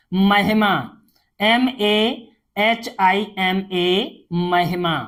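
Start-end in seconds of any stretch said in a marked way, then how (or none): none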